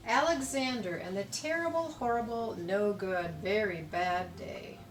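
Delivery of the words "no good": usual speaking voice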